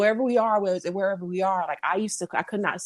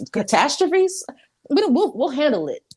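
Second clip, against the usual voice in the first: higher, louder mock-cocky retort; mock bravado; 6 semitones higher, 5 dB louder than usual. Mimicking a state refusing federal help, with mock bravado. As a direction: put on a lightly hammed-up, dismissive boast.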